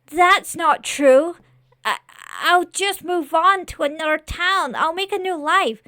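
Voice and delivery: high, loud, stumbling denial; desperate protest. Acted. Scarecrow argues against the lord's warning, desperate protest.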